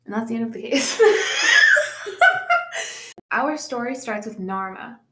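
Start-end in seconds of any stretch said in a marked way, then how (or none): 1.0-3.3 high, loud burst of laughter; high-pitched cackling laugh. Real, not acted. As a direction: burst out laughing at your own joke. Laughs at own joke ending.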